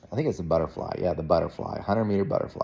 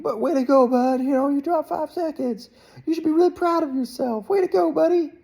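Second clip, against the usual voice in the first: much higher, bright cheering-up voice; upbeat encouraging dad. Retold or imitated. Dad cheering his disappointed swimmer son, high upbeat praise. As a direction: pump him up like a coach on the sidelines.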